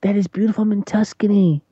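high granny voice in awe and mild fright; old grandmother voice. Retold or imitated. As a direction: squeak it out like an amazed granny afraid to fall. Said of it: "she's like".

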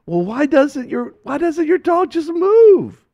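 high, loud, slow incredulity; exasperated question. Retold or imitated. Friend asking why the dog won't move, exasperated. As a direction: wail the question in acted exasperation.

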